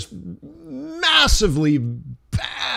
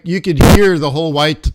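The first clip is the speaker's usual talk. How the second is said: normal talking voice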